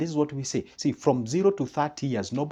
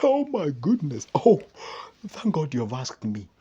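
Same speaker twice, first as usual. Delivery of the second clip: mock horrified friend; 3 semitones higher, 4 dB louder than usual. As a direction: play the gloomy friend hearing the news. Negative friend reacts to plans.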